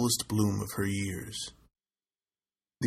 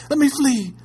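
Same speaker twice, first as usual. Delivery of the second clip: high, loud panicked cry; terrified cry; 8 dB louder than usual. A narrator's reading. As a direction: scream to escape in terror. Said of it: "she cried".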